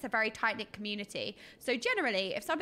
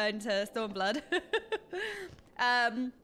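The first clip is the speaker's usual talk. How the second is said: ordinary talk, nothing marked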